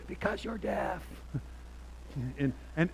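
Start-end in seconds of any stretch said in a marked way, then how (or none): 0.1-1.0 high, strained imitation of an annoyed wife; mad wife. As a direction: mimic an exasperated wife's retort. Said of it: "sounds like she's mad".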